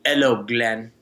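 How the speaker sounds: lightly put-on Russian accent; a hint of mock Russian accent; imitated Russian accent. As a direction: mimic a Russian rival's greeting, accent and all. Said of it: "he says in his Russian accent".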